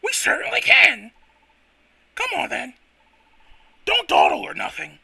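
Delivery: high apprentice voice. Acted. Voicing Timber apprentice.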